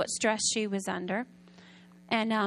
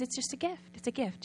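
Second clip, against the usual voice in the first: gentle insistence; 8 dB quieter than usual. Retold or imitated. Giver gently insisting on gift.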